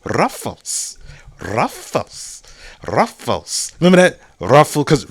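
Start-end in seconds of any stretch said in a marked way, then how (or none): 0.0-3.7 soft, sudden upswoop on each word; commercial imitation. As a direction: mimic a snack-ad catchphrase, quietly. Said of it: "the Ruffles commercial".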